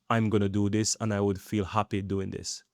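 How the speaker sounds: normal talking voice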